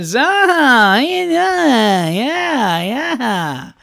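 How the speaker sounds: high, drawn-out singsong doctor hello; doctor greeting. Retold or imitated. Mocking the late doctor greeting the family, slow singsong. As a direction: stretch it out like a breezy doctor. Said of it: "the guy comes in".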